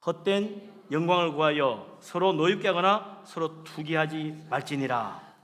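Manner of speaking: slow, measured verse reading; scripture reading aloud. Real, not acted. Preacher leads congregation through a verse, slow recitation. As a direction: read the verse out slowly, stretching the ending. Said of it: "다시 한번 읽어봅시다".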